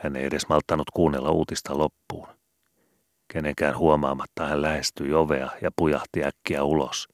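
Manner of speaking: ordinary delivery, nothing marked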